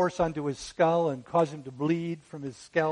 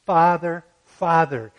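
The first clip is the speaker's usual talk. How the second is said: loud, pleading double call of Father; crying out with emphasis; 2 semitones higher, 10 dB louder, slower than usual. Retold or imitated. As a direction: call out Father twice in anguish. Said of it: "he cries out with emphasis".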